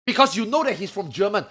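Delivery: ordinary talk, nothing marked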